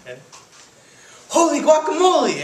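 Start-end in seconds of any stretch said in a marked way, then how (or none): 1.3-2.4 high, loud cartoon exclamation; high-pitched kitten outburst; exclaiming. A narrator's reading. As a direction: cry it out in big astonishment. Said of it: "exclaimed Skippy John Jones".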